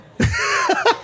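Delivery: a sudden squeal of a laugh; real high-pitched laugh; high burst of laughter. Real, not acted. Laughing at own story.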